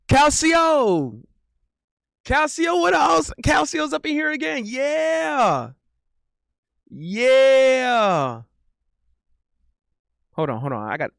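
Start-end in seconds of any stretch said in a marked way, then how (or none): 0.1-4.6 real excited cheer; excited greeting. Real, not acted. 4.7-8.4 long high drawn-out yeah; real hype yell; excited cheer. Real, not acted. Cheering on stream.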